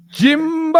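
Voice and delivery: high, loud greeting call. Real, not acted. Greeting the special guest joining the stream, loud high call. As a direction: hail him loudly as he joins.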